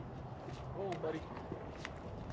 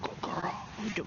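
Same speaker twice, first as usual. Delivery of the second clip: whispered praise; 8 semitones lower, 9 dB quieter than usual. Real, not acted. Soft praise to dog.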